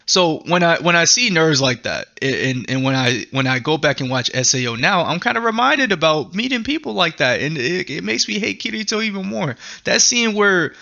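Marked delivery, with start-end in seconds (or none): none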